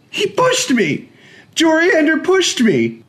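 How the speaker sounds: high, slow, loud wailing accusation; crying boy tattling on his servant; tearful accusing child. A narrator's reading. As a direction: tell on him through tears.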